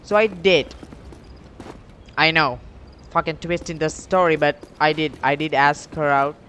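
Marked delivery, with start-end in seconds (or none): none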